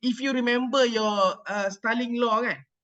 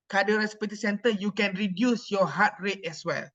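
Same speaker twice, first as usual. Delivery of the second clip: ordinary talk, nothing marked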